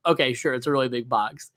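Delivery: low, soft, dismissive retort; humoring, doubtful. Retold or imitated. Teller replaying his reply about the big box, unconvinced. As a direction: drop it low as if placating him. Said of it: "And I'm like".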